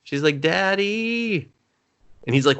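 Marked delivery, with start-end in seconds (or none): 0.4-1.5 high, drawn-out "daughter to dad" call; imitated teen calling her father; daughter calling Daddy. Girl calls her dad from upstairs.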